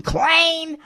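shrill mock squeak on the name; mocking high squeal. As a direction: jeer the name in a falsetto.

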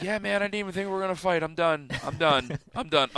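soft, quick give-up; quietly giving up the fight; defeated surrender. Retold or imitated. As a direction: mumble it out of breath and beaten. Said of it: "I'm like".

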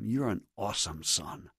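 soft, low, sincere fatherly affirmation; tender blessing. Acted. Parent affirming a son, soft and tender. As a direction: bless them quietly and tenderly. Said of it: "then tell them what God says about them".

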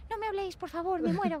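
high, hammed-up gloomy plea; mock emo drama. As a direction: play the gloomy emo teen for laughs. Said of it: "Con toda mi esta de".

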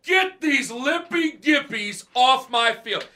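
slow, high, loud coach yell. Retold or imitated. Coach yelling at injured players crutching by, angry shout. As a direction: roar them off the field. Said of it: "he looks over and sees us".

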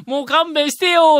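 high, slow fake protest; mock pleading. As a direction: whine it, secretly pleased. Retold mock protest, secretly pleased.

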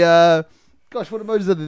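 ordinary talk

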